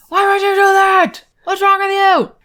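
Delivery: shouted high and loud, grabbing him by the collar; Sherlock yelling. Retold or imitated. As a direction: yell it in his face. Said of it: "he's yelling that".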